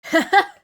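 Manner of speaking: high, loud burst of laughter; quick loud giggle; short laugh. Real, not acted. Reader reacting to a listener, short laugh.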